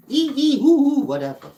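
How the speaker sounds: very high, loud imitated monkey screech; monkey shrieks. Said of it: "Monkeys make their little syllable sounds".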